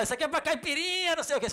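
high, loud joking quote; quoted bystander. Retold or imitated. Someone catching hail for caipirinha, high and loud. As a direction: toss it off as a cheeky joke.

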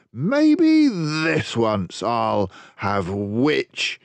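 very slow, relishing dragon voice; greedy dragon. Acted. Dragon licking his lips over the witch, slow and greedy. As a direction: savour every word like a hungry dragon. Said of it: "licking his lips".

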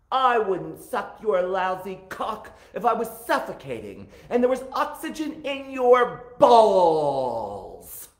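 loud, high outraged movie-line delivery; camp, over-the-top defiant shout; Taffy impersonation. Acted. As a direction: belt it out, stretching the last word long and loud. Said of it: "a cheap impersonation of the flawless way Taffy says that".

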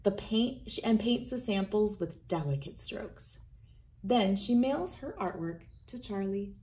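usual speaking voice

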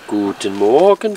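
normal talking voice

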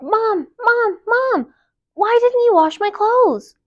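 put-on high, whiny child calling Mom; yelling for mom. Girl yelling downstairs at her mother, high whiny call. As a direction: holler down the stairs, high and pleading. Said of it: "ran downstairs and yelled".

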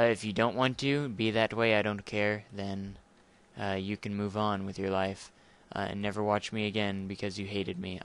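ordinary delivery

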